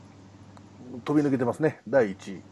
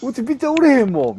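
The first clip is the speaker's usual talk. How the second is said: mock complaining shout; 13 semitones higher, 9 dB louder than usual. Fan boasting of weakness.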